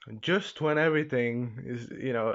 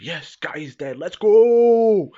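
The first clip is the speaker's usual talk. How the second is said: mock cheering; 6 semitones higher, 13 dB louder, slower than usual. Viewers cheering the death of Guy, exultant shout. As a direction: build to a long, high shouted hurrah.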